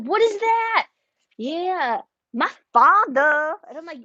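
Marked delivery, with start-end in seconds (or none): 0.0-0.9 what is that. Retold or imitated. Mother reacting to daughter's accent. 1.4-3.5 higher-pitched mock Southern drawl on "my father"; fake Southern accent. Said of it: "has a fake accent she keeps doing".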